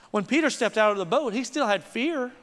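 ordinary talk

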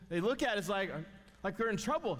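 ordinary delivery

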